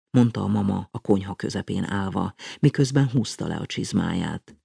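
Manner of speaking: plain reading voice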